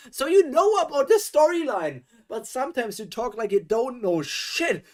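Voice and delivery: loud, high-pitched indignant rant; exasperated outburst. Real, not acted. Reader interrupts the story to scold its narrator, exasperated yelling.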